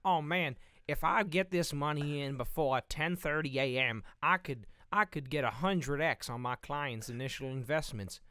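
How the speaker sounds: loud, hyped finance bro; eager go-getter bragging to the room; cool Albert voice. Acted. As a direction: pump it up like a hustler sure of his big money. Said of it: "He would be like".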